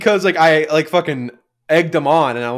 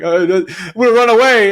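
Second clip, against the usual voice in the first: higher-pitched imitated tearful outburst; mock crybaby whine; 6 semitones higher, 4 dB louder than usual. Dramatic child threatening to leave, mock tearful whine. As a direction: whine it higher, mimicking your childhood self. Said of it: "used to cry and be like".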